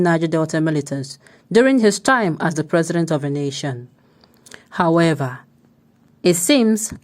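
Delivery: steady, firm news-anchor read. Acted. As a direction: deliver it like a news bulletin. Reads a news report aloud.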